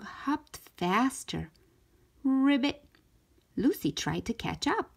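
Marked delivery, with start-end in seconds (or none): 2.2-2.8 bright, high ribbit croak; frog ribbit. A narrator's reading. Storyteller voicing the frog's call, high ribbit.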